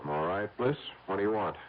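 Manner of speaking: tense, muted phone voice; hushed on the phone. Acted. Hushed phone call at night.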